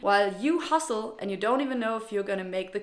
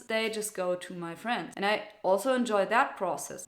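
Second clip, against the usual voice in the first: ordinary talk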